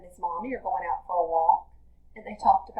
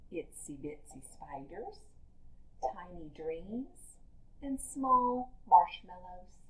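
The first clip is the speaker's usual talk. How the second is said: softer, small and delicate; tiny soft voice; 9 dB quieter than usual. Acted. Teacher voicing tiny baby talk for the class, soft and small. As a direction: hush it down like something tiny. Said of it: "talk in tiny baby voices".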